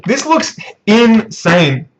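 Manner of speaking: high, slow awestruck exclamation; swooping, stretched-out astonishment; amazed excitement. Real, not acted. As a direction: draw out each word in hyped amazement. Gasping at full reveal.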